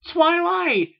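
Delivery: very high, loud shout; panicked cry. A narrator's reading. Filly remembering the explosion, crying out in panic. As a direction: cry out the name in alarm. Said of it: "In a panic".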